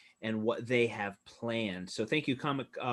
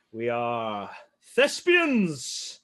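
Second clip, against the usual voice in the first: hammed-up grandeur; 2 semitones higher, 5 dB louder, much slower than usual. Hamming up theater pride.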